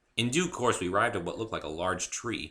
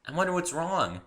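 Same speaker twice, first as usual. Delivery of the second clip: bubbly pony; 10 semitones higher than usual. A narrator's reading. Pinkie explaining Spike to the newcomer, high and bubbly. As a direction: chirp it like a cheerful pony.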